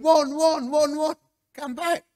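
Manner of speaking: high, urgent mock call. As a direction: call them back before they leave the room. Calling men back as they leave.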